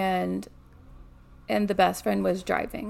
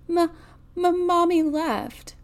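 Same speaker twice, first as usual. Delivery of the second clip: put-on tearful child; 10 semitones higher, 4 dB louder than usual. A narrator's reading. Grace bursting into tears to her grandmother, tearful stammering child. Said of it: "Grace burst into tears".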